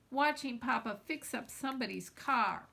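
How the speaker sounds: usual speaking voice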